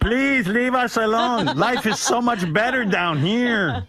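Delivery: high, slow, half-laughing miner voice; hammed-up grateful miner, laughing through it; mock trapped miner. Voicing miners as a joke.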